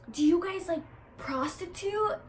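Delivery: lightly voiced earnest stranger asking delicately; mock concerned woman. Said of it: "and she's like".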